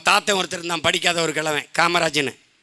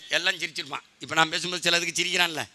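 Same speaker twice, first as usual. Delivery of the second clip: usual speaking voice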